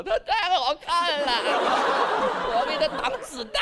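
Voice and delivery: high, gushing adoration, hammed up; voice of emotion. Besotted father's emotional side speaking, high hammed-up adoration. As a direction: gush in a strained high voice. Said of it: "我的感性告诉我".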